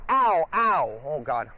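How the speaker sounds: sudden loud cry of pain; pained yelp. Real, not acted. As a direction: yelp as the teeth catch your hand. Bitten on the hand by the dog.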